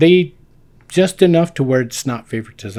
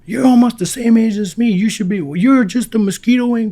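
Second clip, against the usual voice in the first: higher and louder mock sergeant; imitating the sergeant; 11 semitones higher, 5 dB louder than usual. Sergeant ribbing an older recruit, mock drill-sergeant bark.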